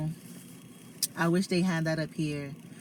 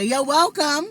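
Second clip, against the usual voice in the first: high, loud New Yorker "You're welcome"; typical New Yorker; 10 semitones higher, 8 dB louder than usual. Retold or imitated. Imitating New Yorkers answering a stranger, loud and high. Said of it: "Typical New Yorker".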